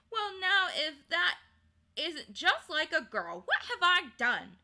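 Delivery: high, loud protest; indignant exclamation. A narrator's reading. Digory protesting to Polly that he did nothing, exclaiming. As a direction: burst out in outraged complaint. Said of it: "exclaimed Diggory".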